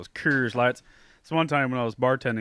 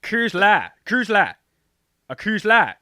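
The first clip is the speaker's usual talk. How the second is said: put-on Southern accent; 9 semitones higher, 10 dB louder, slower than usual. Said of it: "I'm not doing it justice at all".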